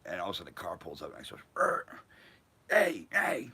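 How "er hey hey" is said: high, loud, put-on calling voice; calling out as Sid. Sid pulls up and calls to the skaters, high and loud. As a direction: hail them from the car window, pitched way up.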